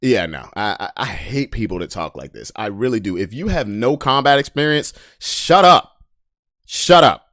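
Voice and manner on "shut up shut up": loud, high repeated shut up; sharp yell. Real, not acted. Ranting at wannabe fighters on stream, loud shut up. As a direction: bark it at them, louder and higher.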